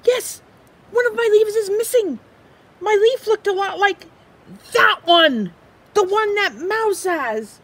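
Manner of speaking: high, fast, frantic little squirrel voice; excitable squirrel. Acted. Squirrel frets over his missing leaf, high and anxious. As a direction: play the squirrel, jumpy and loud.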